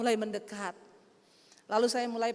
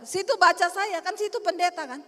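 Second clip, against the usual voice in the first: higher-pitched challenge; mildly pushy demand, pitched up; confrontational woman; 6 semitones higher, 4 dB louder than usual. Retold or imitated. Acts out hostile woman challenging pastor.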